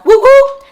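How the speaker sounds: high, loud woo-woo siren sound; imitated police siren. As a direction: mimic a cop car siren.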